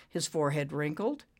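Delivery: ordinary delivery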